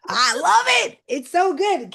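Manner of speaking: high, loud squeal of delight; real delight. Real, not acted. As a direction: gush over a gift you love. Delighted by gift mug.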